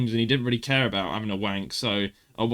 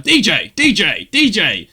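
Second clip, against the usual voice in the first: loud repeated DJ call; spamming DJ button; 5 semitones higher, 7 dB louder, slower than usual. Retold or imitated. Students spamming the keyboard button in class, loud repeated calls. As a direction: chant it like a looping sample. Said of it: "one of the students was like".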